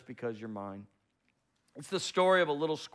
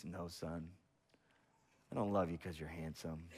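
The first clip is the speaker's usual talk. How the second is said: lower, soft fatherly voice; calm dad saying no; a hint of a tender father; dad's gentle no; 8 semitones lower, 7 dB quieter than usual. Dad squirrel answers.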